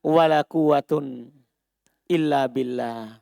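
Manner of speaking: measured reading of la haulun wala quwatun; slow recitation. Shown as a demonstration. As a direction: recite it deliberately to the class. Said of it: "Kembali bacaan di awal".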